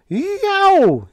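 very high, loud howl; terrific whoop. Acted. Biggs howling at startling news, terrific whoop. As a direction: whoop it out at the top of the voice. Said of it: "he loosed a terrific whoop".

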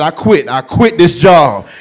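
loud, slow I quit this job; loud quitting. Retold or imitated. Terrified usher quitting on the spot. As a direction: declare it, drawing it out.

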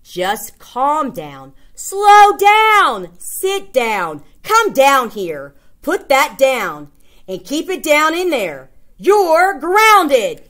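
hammed-up parent telling off a kid; scolding parent. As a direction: parent laying down the law. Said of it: "When you're a parent".

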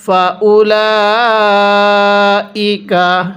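ফাউলাইকা with lam held four alif long, very slow; slow Quranic chant; four-alif stretch. Shown as a demonstration. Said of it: "কিভাবে পড়ব?".

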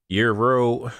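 "Euro" with a drawn-out r. Shown as a demonstration. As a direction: stretch the r in "Euro". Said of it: "I'ma say it like that".

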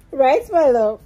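laughing. Real, not acted. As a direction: burst out laughing mid-question. Laughing at the baby.

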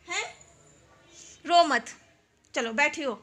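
coaxing grandma. Real, not acted. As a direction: coax her gently to stop crying. Coaxing crying elderly grandmother.

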